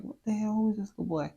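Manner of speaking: high, loud shocked outburst; a loud, high what-the-hell; startled. Retold or imitated. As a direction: blurt it out as a ghost appears. Startled by a ghost boy.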